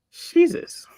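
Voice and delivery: breathy high gasp of Jesus; shocked under the breath; stunned whisper. Real, not acted. Streamer sees a giant dog photo, stunned whisper.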